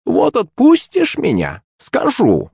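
high, slow creaking hag; creaky crone voice. Acted. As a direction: creak it out like an old witch. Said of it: "проскрипела старуха".

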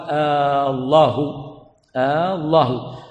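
"الله" opened with a long held "آه" before it; stretched, question-like "آ" starting "الله"; lengthened hamza. Shown as a demonstration. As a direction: drag the first vowel of "الله" out slowly. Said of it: "فإن مد همزة الله".

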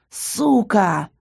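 drawn-out strangled croak; wheezed. A narrator's reading. Count choked against the wall, hoarse wheeze. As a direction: rasp it out through a squeezed throat. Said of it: "прохрипел он".

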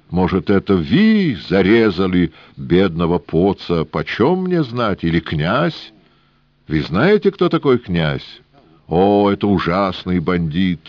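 put-on high, agitated Yiddish-accented old man. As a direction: protest with a wailing Yiddish lilt. Rubinchik indignant.

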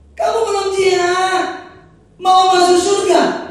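high, shouted challenge; loud rebuke. Acted. Preacher voicing God rebuking the congregation, shouted challenge. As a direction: shout the question at them.

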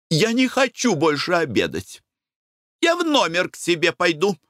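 high-pitched huffy announcement; irritated. A narrator's reading. Wife leaving the table in annoyance, irritated. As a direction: declare it crossly, pitched well up. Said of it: "сказала она раздражённо".

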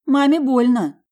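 high, loud mock pained cry; exaggerated cry. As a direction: overplay the hurt. Mother cries out at bite.

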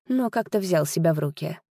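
usual speaking voice

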